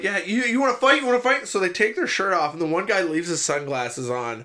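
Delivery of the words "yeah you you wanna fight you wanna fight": higher, louder goading challenge; mock tough taunt. Hockey guy taunting Wade to fight, higher mock jeer. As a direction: goad him like a hockey goon.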